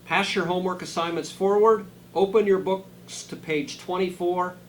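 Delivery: high, loud, commanding schoolteacher orders; stern teacher. Acted. Strict teacher orders her pupils to sit, loud stern command.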